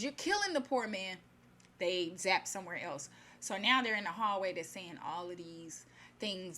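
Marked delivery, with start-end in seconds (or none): 0.0-1.2 higher-pitched mock outcry; retold cry of alarm; retold scream. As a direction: cry out in protest, not at full force. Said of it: "as soon as he screams".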